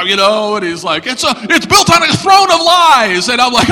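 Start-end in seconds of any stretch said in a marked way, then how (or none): 1.1-3.3 high, loud debater on the attack; hammed-up denouncing; mock outraged shout. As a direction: thunder it like an accuser. Atheist attacks the speaker's faith.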